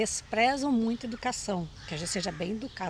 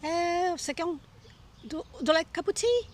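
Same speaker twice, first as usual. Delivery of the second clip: lightly acted tea host, higher pitch; offering tea; 7 semitones higher than usual. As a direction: put on a high, hospitable voice to offer a cuppa. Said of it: "eles oferecem muito chá pra vocês".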